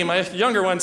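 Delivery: normal talking voice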